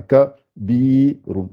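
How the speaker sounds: "bi" drawn out between "ka" and "run"; lengthened i. Shown as a demonstration. Teacher showing what ya does, "ka, bi, run" with long "bi". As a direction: break into "ka, bi, run", holding the i. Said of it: "il peut allonger que le i".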